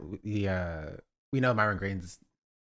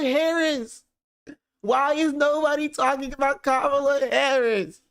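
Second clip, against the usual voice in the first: high, fast whine of a sobbing man; mock him like a crybaby wailing about his party; high-pitched whining parody of a crying man; whiny crying impression; 7 semitones higher than usual. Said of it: "He's like".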